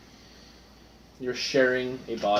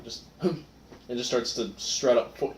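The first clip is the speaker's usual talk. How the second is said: usual speaking voice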